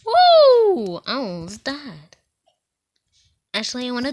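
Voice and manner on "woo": very high, loud cheer; real triumphant woo; victory whoop. Real, not acted. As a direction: shout for joy at the win. Finishing the game.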